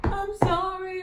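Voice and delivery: high, loud, drawn-out mock plea; melodramatic apology. Vlogger re-enacting boyfriend following her in rain, melodramatic apology. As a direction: wail it like a corny romance hero.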